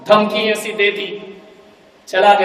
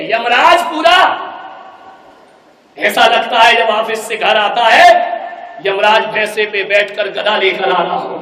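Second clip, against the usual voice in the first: mocking rant; 2 semitones higher, 6 dB louder than usual. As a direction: ridicule the husband as Yama. Daughter mocks husband.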